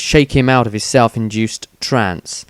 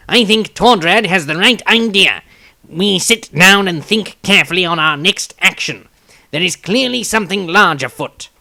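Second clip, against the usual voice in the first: higher, louder halfling voice; a small earnest halfling urging calm, pitched well up; halfling Tobias, earnest; 8 semitones higher, 5 dB louder than usual. A narrator's reading.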